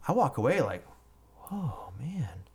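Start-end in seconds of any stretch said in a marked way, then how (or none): 1.5-2.4 whispered to himself, retold; stunned whisper. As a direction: act out a hushed, chastened reaction. Retold walking away chewed out.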